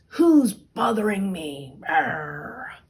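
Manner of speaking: loud, gruff ogre bellowing; ogre roar. Acted. Ogre bellowing at whoever pounds on his door.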